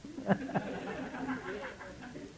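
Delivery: long, soft high chuckle; soft laugh. Real, not acted. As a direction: chuckle softly and keep it going. Cười tiếp sau câu đùa. (cười khúc khích nhẹ)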